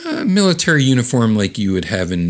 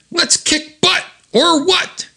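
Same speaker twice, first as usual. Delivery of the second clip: very high, excited comic-book cry; high-pitched call to battle; gung-ho rallying cry; slower than usual. Acted. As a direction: pipe it up high to fire up the team. Acted rallying cry, then quoted plainly.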